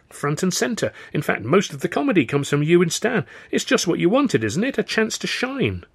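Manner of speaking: high, eager pitch to win her over; excited persuasion. A narrator's reading. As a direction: sell the chance with rising enthusiasm. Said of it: "swept up in my excitement".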